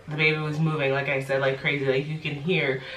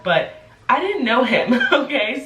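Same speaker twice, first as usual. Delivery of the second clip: higher, louder, laughing through it; laughing disbelief; 8 semitones higher, 5 dB louder than usual. Real, not acted. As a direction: laugh it off, incredulous.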